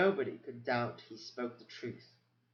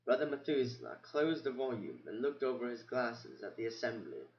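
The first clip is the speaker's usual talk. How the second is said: ordinary delivery, nothing marked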